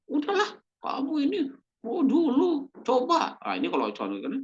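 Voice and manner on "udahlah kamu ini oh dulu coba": high-pitched, exasperated nagging; harsh scolding. Retold or imitated. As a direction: lose your temper and snap at them. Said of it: "Malah kasar kata-katanya". (omelan kasar bernada tinggi)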